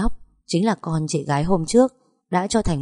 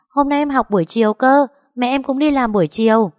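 put-on high, bright-eyed child chatter; little boy voice; 7 semitones higher, 5 dB louder than usual. Small boy telling the neighbour about school, high child voice. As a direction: voice a small boy eagerly explaining. Child character Đậu Đũa speaks.

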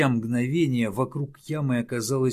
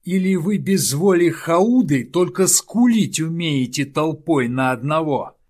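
roaring; 5 semitones higher, 8 dB louder than usual. Acted. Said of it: "заревел Ювенис".

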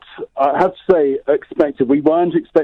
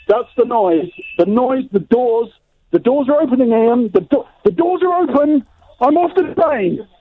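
excited; 10 semitones higher than usual. Acted. Arriving live, doors opening.

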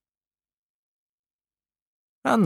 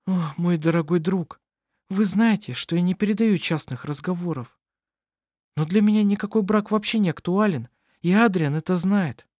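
lightly raised, gentle woman's voice; young woman voice; 8 semitones higher than usual. Acted. Anna-Maria speaks to Kohl.

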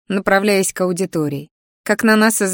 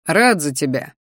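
lower, cool dismissive reply; dry smirk; 4 semitones lower than usual. Acted. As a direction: brush her off with a smirk. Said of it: "хмыкнул Кер".

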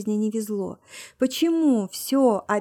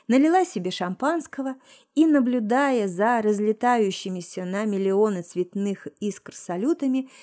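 plain reading voice

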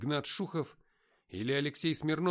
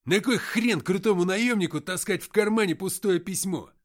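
bang the table and yell; shouting; 6 semitones higher, 10 dB louder than usual. Acted. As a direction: gang boss yelling and slamming the table. Antokha keeps yelling.

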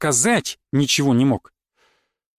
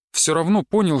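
plain reading voice

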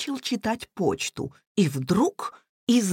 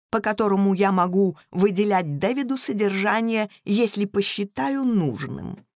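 usual speaking voice

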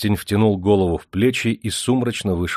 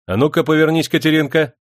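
high, loud order; raised voice of a master giving orders; bossy command; 9 semitones higher, 6 dB louder than usual. A narrator's reading. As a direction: order the girl sharply. Master orders the girl about.

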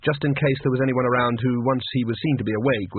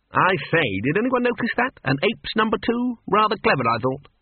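put-on high, self-satisfied old don; preening over his own joke; smug old wizard; 8 semitones higher than usual. A narrator's reading. Dean pleased with himself.

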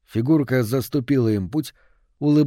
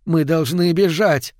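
higher, slow, urgent cry; shouting over wind; 5 semitones higher, 4 dB louder, slower than usual. A narrator's reading. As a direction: call out as if over howling wind. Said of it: "крикнула мать, стараясь перекричать завывание ветра". (крик сквозь вой ветра)

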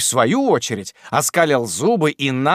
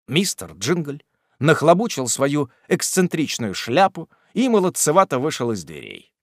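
ordinary delivery

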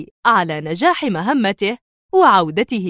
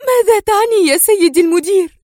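higher, louder frightened question; alarmed question; 9 semitones higher, 5 dB louder than usual. Acted. Sonia asking her director what he means, alarmed. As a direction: she fears what she is about to hear. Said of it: "اتسعت عيناها دهشة وذعرا".

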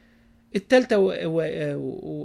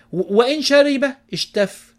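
raised, slow and forceful; emphatic proclamation; 8 semitones higher, 7 dB louder, slower than usual. Acted. Preacher proclaiming a saying to men, loud and slow. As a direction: proclaim it slowly to the congregation. Preacher booms key phrase.

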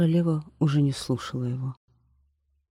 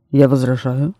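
louder, emphatic royal protest; firm objection; 9 dB louder than usual. Acted. King objecting to his wife sitting on a tree, loud firm protest. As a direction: put your foot down as king.

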